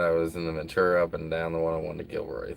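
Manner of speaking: ordinary talk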